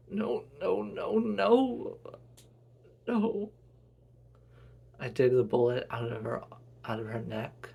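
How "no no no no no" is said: higher, louder desperate denial; rising grief-stricken cry; anguished no. Acted. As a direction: refuse to accept her death. Son watching mother die.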